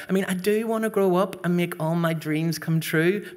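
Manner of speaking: ordinary talk, nothing marked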